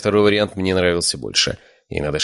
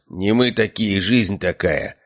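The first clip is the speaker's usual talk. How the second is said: ordinary delivery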